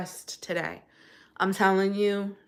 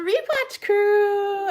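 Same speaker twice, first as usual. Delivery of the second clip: singsong greeting; 11 semitones higher, 5 dB louder, much slower than usual. Real, not acted. Singsong greeting to live viewers.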